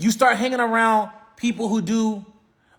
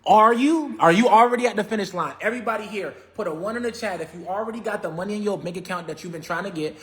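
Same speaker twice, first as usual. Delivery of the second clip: normal talking voice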